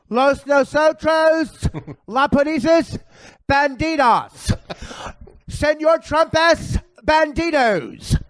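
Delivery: high, slow effeminate voice with mock Spanish; hammed-up effeminate fake Spanish, high and slow; Tim Kaine impression. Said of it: "my Herman Tim Kaine character".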